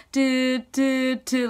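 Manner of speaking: imitated busy-signal beeps; slow, even "do, do, do" like a dead line; dead phone-line tone. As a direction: mimic a disconnected phone's beeping. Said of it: "like, the line is dead".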